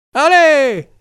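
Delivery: very high, loud cheer; cheering kids. Acted. The boys cheering the idea, high and loud. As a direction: whoop together like boys.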